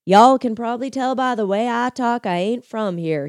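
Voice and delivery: high, drawn-out Georgia drawl; folksy Southern accent. Acted. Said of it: "he drawled".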